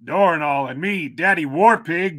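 slow, loud show intro; announcer voice. Acted. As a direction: announce the podcast opening.

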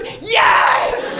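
loud mock cheer; echoing the tantrum shout; retold yell. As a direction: shout it back like the bodega woman. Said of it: "I scream and she goes".

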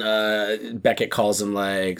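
ordinary delivery

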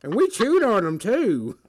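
cracking up mid-bit; laughing old man. Pretend.

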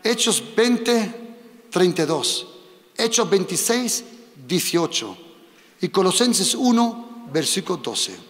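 teacher dictating verse numbers to a volunteer, slow and clear; slow dictation. Shown as a demonstration. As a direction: dictate the verses slowly for the scribe. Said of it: "Escríbelo así, solo los versículos".

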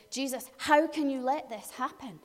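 usual speaking voice

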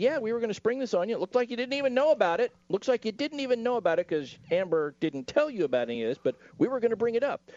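high, fast smug taunt; high, quick mock-gloating attorney voice; gloating lawyer quote. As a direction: rattle it off like a lawyer springing a trap. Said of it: "Depp's attorney said".

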